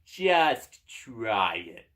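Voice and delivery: slow, loud taunting door; talking door. Acted. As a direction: draw it out as a loud door's dare. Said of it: "says the door".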